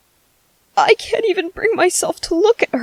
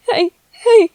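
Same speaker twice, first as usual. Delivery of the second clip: higher, louder, voice cracking; tearful hushing; 6 semitones higher, 4 dB louder than usual. Acted. Parent trying to calm baby while crying. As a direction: comfort through your own tears.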